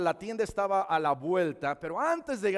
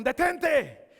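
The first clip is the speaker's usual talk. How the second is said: sudden higher-pitched order to halt; retold stern command; 9 semitones higher, 6 dB louder than usual. Armed strangers order the boy to stop, sharp command. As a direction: act out a man ordering someone to stop.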